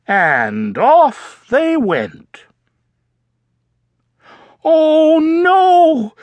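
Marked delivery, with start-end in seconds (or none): 4.6-6.1 high loud oh no; dismayed cry. Acted. Froggy realizing he forgot something, high dismayed cry. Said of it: "cried Froggy".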